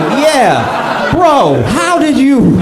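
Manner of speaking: laughing in disbelief at his shoes; incredulous shout. Retold or imitated. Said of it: "And it's like".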